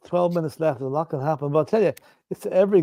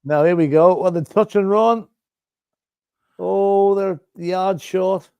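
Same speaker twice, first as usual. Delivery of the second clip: loud, raised, building call; excited live commentary; 5 semitones higher, 5 dB louder, slower than usual. Real, not acted. Fan watching play near the try line, excited commentary. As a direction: call the near miss loudly and high.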